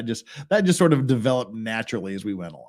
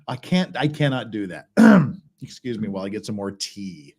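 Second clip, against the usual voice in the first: ordinary talk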